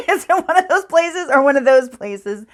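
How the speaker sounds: helpless laugh bubbling through the words. Real, not acted. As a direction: crack up while saying it. Laughs at own muddled directions.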